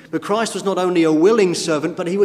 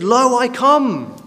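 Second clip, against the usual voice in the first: slow, raised proclamation; proclaiming; 7 semitones higher, 4 dB louder, much slower than usual. Acted. Preacher voicing Christ's willing answer to the Father, slow and raised. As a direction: ring out each word slowly like a solemn vow.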